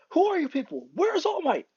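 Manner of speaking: high-pitched, panicked demand; startled panic. Acted. Boy wakes among strangers, high panicked questioning. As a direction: cry out in alarm at strangers.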